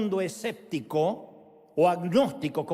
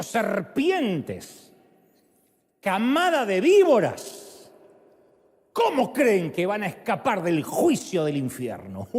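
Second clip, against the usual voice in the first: fierce denunciation; 3 semitones higher, 6 dB louder, slower than usual. Acted. Jesus denounces vipers.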